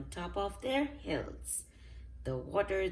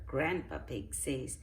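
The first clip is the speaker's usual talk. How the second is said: usual speaking voice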